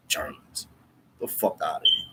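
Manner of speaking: low, muttered dismissal; dismissive scoff. Real, not acted. Rejecting being called Germans by others, dismissive. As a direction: scoff it away under your breath.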